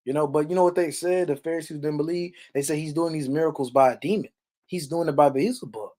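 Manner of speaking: ordinary delivery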